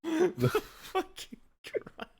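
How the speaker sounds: slow words through laughter; laughing disbelief. Real, not acted. Friend reacting to the raunchy passage, laughing. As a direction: caught between laughing and disbelief.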